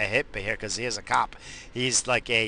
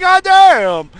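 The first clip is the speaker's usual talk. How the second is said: much higher, loud startled yell; startled shout; 17 dB louder than usual. Retold or imitated. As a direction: blurt it out in shock, full volume. Bobo reacts to the fart.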